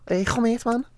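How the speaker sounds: high-pitched mock Scouse; Scouse impression. Showing off a Scouse friend's greeting, high Scouse accent. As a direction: put on a squeaky, throaty Scouse voice. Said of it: "he's Scouse".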